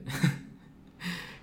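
breathy laugh at own tangent; little laugh mid-sentence. Real, not acted.

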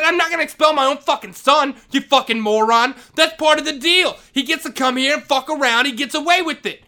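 angry yell. Retold or imitated. Vice principal refusing to expel his son, angry yell. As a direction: yell at the moron. Retold shouting match with principal.

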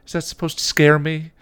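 put-on high, loud teenage scoff; unimpressed kid sister. A narrator's reading. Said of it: "She scoffed at him".

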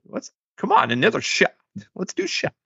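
high, pushy party guy; pushy drunk voice. Retold or imitated. Mimicking Bob pushing shots.

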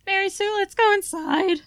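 high, urgent plea; urgent character voice. Acted. Character urges group inside.

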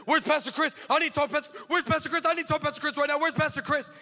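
high, insistent acted outburst; frantic demanding woman. Woman storms the pastor's office demanding him, frantic. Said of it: "this woman storms my office".

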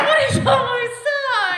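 high, loud sing-song complaint; mock whine. Real, not acted. Complaining she's outvoted.

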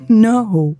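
a laughing no. Acted. Louise stopping Elle's hand, laughing. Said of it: "Louise laughed".

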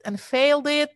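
"failed" punched high on the repeat; emphatic repeat. Real, not acted. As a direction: hit "failed it" hard the second time, with dismay. Repeats her exam failure emphatically.

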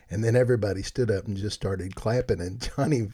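normal talking voice

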